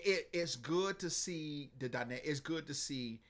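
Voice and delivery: normal talking voice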